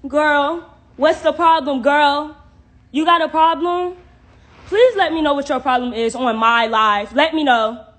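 loud, challenging call-out; confrontational. Real, not acted. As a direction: challenge her to name her problem, loudly. Confronts a commenter on live.

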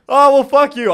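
mock outraged shout. Real, not acted. Storyteller shouting back at the cohost's insult, mock outrage. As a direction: fire back in playful indignation.

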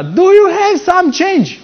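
high, slowed-down holler; megaphone yell. Retold or imitated. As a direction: imitate the beggar's long blaring call. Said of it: "spre noi și a urlat".

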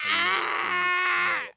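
loud sudden yell; startled shout. Real, not acted. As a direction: yelp in alarm.

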